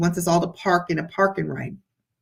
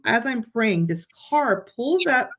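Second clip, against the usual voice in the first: usual speaking voice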